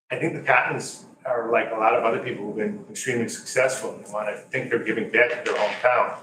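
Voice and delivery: usual speaking voice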